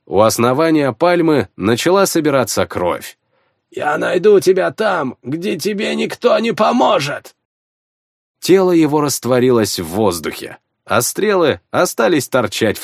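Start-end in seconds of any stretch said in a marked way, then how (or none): 3.7-7.3 raspy, higher wheezed threat; hoarse. A narrator's reading. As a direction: croak it out through pain. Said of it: "прохрипел он".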